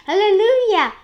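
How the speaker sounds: loud, high exclamation; jubilant cry. A narrator's reading. An aunt celebrates the giant peach, jubilant cry. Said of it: "and Spike goes out, heard it".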